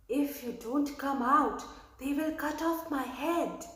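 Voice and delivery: usual speaking voice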